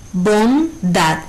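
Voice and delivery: BONDAD split in two, bon, dad; syllable by syllable. Shown as a demonstration. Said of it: "su sílaba tónica es dad".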